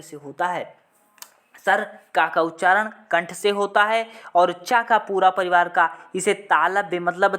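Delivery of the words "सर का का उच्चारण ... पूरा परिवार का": ordinary delivery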